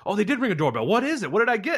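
high, eager questions; gushing over a surprise package; excited wife. Retold or imitated. Wife hearing about a present, excited and high. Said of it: "And she goes".

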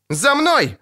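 high urgent command; крик. A narrator's reading. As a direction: call them to follow, pitched high. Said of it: "крикнула чародейка". (крикнуть высоким голосом, командуя)

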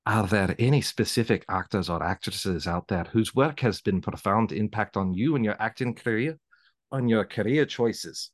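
amateur Scottish character voice, measured delivery; Scottish accent. Shown as a demonstration. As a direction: read it in an attempted Scottish accent. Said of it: "can I hear the Scottish".

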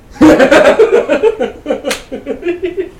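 a high, loud burst of laughter; real laugh. Real, not acted. Amused by the Babylon Bee headline, a real loud laugh.

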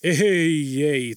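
mild, emphatic refusal; protesting. A narrator's reading. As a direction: hold them back with a protest. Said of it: "toputteli Jorma". (toppuutteleva kielto)

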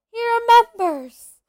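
high, loud delighted cry; excited cry. A narrator's reading. Diggle crying out to Harry, overjoyed and loud. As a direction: burst out thrilled to be remembered. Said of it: "cried Diddles Diggle".

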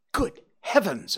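high, startled exclamation; shocked cry. A narrator's reading. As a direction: recoil at the sight of the wound. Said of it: "I cried".

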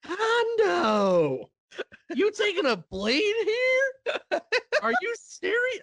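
very high, incredulous squeal; freaking out. Retold or imitated. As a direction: re-enact panicking at a reckless wrestler. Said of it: "freaking out when Pondo was taking".

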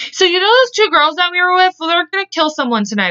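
high-pitched gossipy blurt; retold blurting. Tony telling the boys about the plan, imitated high blurt. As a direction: spill the secret in a high, eager voice.

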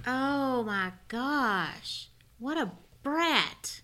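slow, drawn-out disbelief; dismayed disbelief. Real, not acted. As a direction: drag it out in dismay. Listener reacts to story.

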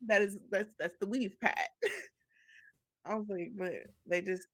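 usual speaking voice

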